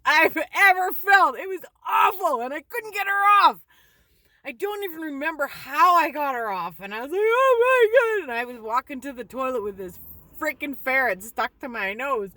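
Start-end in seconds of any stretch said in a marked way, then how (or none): 0.1-3.6 hammed-up pained outcry; reenacted agony. Reliving the pain of the bite. 7.2-8.3 long, high, loud wail; mock agonized shriek; reenacted pained scream. Retold cry with ferret on nose.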